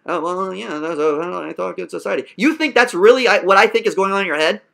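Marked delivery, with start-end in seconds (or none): 0.1-2.3 soft, low mumbling imitation; mocking his mumble. Coach imitating the student's meek mumbling. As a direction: mimic his timid, hesitant voice. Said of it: "you talk like this".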